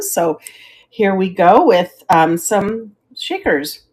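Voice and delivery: ordinary delivery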